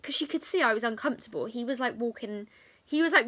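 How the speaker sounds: normal talking voice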